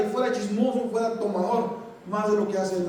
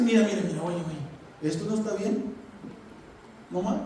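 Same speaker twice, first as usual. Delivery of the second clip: softer, lower coaxing voice; gentle correction; 4 semitones lower, 7 dB quieter than usual. Pretend. Preacher shows wife gently correcting husband, soft and coaxing. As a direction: coax him gently, not scolding.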